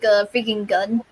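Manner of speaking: ordinary talk, nothing marked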